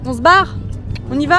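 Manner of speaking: loud, high call to wake friends. Real, not acted. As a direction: shout across the boat to the group. Waking up sleeping friends on boat.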